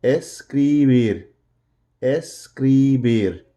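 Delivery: "Escribir" stretched slowly, long held "cri"; drawn-out syllables. Shown as a demonstration. Teacher models the verb for students, drawn-out syllables. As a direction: sound out "Escribir" slowly for learners. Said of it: "so let's try it".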